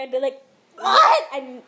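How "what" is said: high, very loud mock shriek; pretend freak-out. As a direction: explode in starstruck disbelief, half laughing. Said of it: "I would freak".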